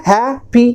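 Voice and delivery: "Happy" broken as hae, pi, with a gap; split into syllables. Shown as a demonstration. Teacher counting two syllables, each said apart. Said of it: "दो syllable हो गए ना".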